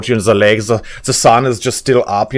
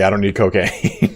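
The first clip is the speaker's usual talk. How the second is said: ordinary talk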